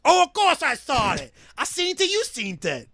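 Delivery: high, loud mock Brooklyn Italian; insistent hammed-up Brooklyn Italian guy; Brooklyn Italian accent. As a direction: argue like a Brooklyn Italian stagehand. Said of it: "Italians from Brooklyn".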